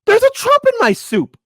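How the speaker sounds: high, loud mock diner complaint; hammed-up joke voice. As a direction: shout the punchline like a cartoon customer. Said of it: "Brian's Biscuit says".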